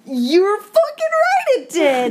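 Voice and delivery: high, drawn-out indignant yell; exasperated outburst. Real, not acted. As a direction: explode in vehement agreement. Outburst about how bad it was.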